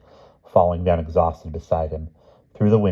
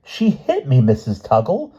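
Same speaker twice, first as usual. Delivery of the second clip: high, loud shocked complaint; high-pitched indignant report; 7 semitones higher, 6 dB louder than usual. A narrator's reading. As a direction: protest in shock to an adult. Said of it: "sounding shocked".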